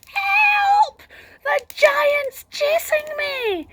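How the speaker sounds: high, loud, slow cry for help; panicked shout. Acted. Girl running into the village shouting for help, high and panicked. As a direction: scream for help as you run. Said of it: "she shouted".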